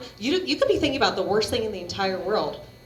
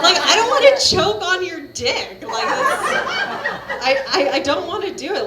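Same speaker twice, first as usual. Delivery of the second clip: laughing; 7 semitones higher, 5 dB louder than usual. Real, not acted. Laughing rant about choking.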